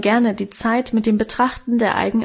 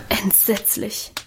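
soft, slow sigh of dismay; 7 dB quieter, slower than usual. A narrator's reading. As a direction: breathe the word out with a sigh. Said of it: "seufzte Adele".

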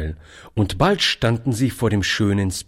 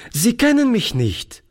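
lively; 14 semitones higher than usual. A narrator's reading. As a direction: plead brightly and fast. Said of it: "sagte Toni lebhaft".

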